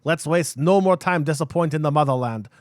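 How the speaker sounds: loud mock Soviet comrade with Russian accent. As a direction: put on a hammed-up Russian accent, slowing at first. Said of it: "You had some good Russian there".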